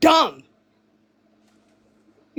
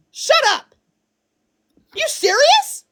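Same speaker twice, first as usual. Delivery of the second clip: high incredulous; 11 semitones higher than usual. Real, not acted. Disbelief at chat.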